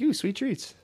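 high, soft delighted ooh; delighted coo. Acted. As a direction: coo over the candy like a child. Mocking protesters lured by candy.

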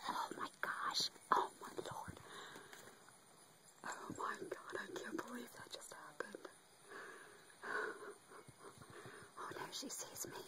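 hushed whisper while sneaking up. Real, not acted. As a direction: whisper so you're not heard. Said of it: "Gotta sneak up here".

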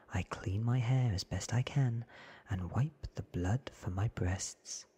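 plain reading voice

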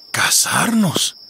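slow, doubtful echo; surprised cat. Acted. Cat to the fox, slowly taken aback. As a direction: let the idea sink in slowly.

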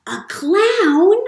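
put-on excited guess to the children; excited question. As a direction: ask it bursting with delight.

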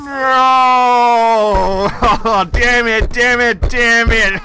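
yelling no, then damn it over and over; loud rage at losing the streak; real gamer rage, shouting; furious shout. Real, not acted. Losing his killstreak reward.

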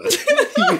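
high nervous laugh; burst of laughter. Real, not acted. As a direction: laugh off the fright. Laughing after scare.

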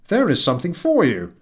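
high, loud triumphant offer. Acted. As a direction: present the gift proudly. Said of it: "with a triumphant air".

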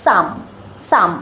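সাম with a short ʌ vowel, carefully modelled; teacher says সাম with the ʌ sound; pronunciation demo. Shown as a demonstration. Teacher contrasting some with dome, careful ʌ vowel. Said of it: "এটার উচ্চারণ হবে সাম".

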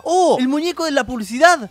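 a startled, excited gasp of recognition; surprised outburst. Real, not acted. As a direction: jump at what you see. Recognizes the ad's toy.

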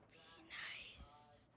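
unvoiced hushed whisper of be nice. Real, not acted. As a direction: breathe be nice without voice. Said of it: "Be nice. (said plainly first)".

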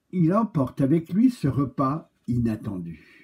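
plain reading voice

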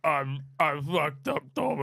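slow slurred gangster voice; drawn-out Birmingham gangster; Arthur Shelby voice. Pretend. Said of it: "do Arthur Shelley".

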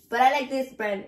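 ordinary delivery

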